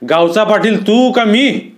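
high, indignant challenge; flaring anger. A narrator's reading. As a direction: lash out at an upstart. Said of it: "रागानं भडकन म्हणाले".

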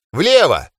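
high, loud command; shouted command. Acted. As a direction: call the turn. Commanding a turn while fleeing.

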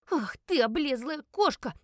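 high, loud furious insult; злобный ор. A narrator's reading. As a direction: yell it at a hated rival. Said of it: "орала Элис". (злобно орёт, высоким голосом)

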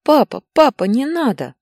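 high, loud frightened plea; crying child. A narrator's reading. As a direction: beg your father like a tearful boy. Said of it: "заплакал Илья". (плачущая детская мольба)

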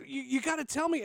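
high urgent pleading; panicked caller. Retold or imitated. Panicked trader pressing the intern, high and urgent. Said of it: "calls up in a panic".

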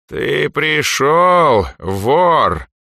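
slow, high, loud creaky rasp. Acted. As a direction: drag out a creaking menacing voice. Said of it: "незнакомый скрипучий голос". (скрипучий голос, медленный и громкий)

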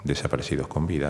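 ordinary talk

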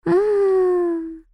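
long, high "mmm" groan; drawn-out exasperated hum, sliding down slightly; moan. A narrator's reading. Ann despairing of her niece, moaning. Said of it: "простонала Энн".

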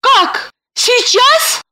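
high-pitched startled squeak; taken aback, voice jumping way up; surprised. A narrator's reading. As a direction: ask in high, astonished disbelief. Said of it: "удивилась Липочка".

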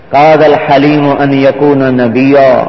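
slow, measured Arabic recitation; recited hadith. Acted. Prophet answering Umar with a saying, slow solemn recitation. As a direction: intone the saying slowly and gravely.

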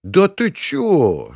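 high, stretched-out jeer; slow derisive gang-leader scoff; laughing mockery. A narrator's reading. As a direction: draw it out high in amused disbelief. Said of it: "рассмеялся царёк".

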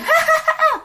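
high cackling ghost laugh; eerie ghost laugh. Retold or imitated. Ghost woman laughs at the trapped man, a high eerie cackle. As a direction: let out a creepy cackle. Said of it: "cười thấy ghê lắm".

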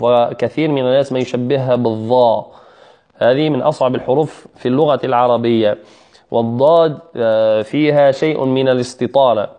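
ordinary talk, nothing marked

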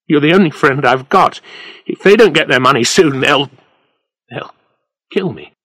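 frightened plea that falters into broken stops; shaking voice. A narrator's reading. Freddie tells his brother-in-law he may be killed, frightened and faltering. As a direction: start high and loud, then stumble on the last words. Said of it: "His voice was shaking".

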